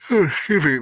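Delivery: stifle a yawn while speaking. Real, not acted. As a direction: yawn mid-sentence. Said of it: "apologize for the yawning".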